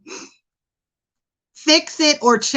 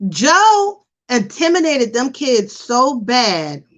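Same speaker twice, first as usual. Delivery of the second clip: usual speaking voice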